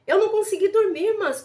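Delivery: high, loud retold outburst; acted urgent confession, pitched high; emphatic insistence. Retold sister insists she couldn't sleep.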